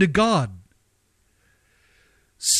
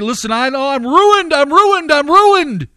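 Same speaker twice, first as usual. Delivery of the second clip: rising anguished cry of I'm ruined, louder each time; imagined despairing sinner; 8 semitones higher, 5 dB louder than usual. Pretend. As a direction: cry out as a broken sinner who has lost hope. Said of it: "some right now are gonna say".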